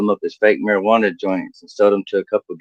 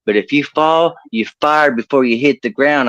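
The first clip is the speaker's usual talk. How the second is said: raised-pitch country foreman giving orders; 5 semitones higher than usual. Acted. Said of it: "my boss was redneck as hell".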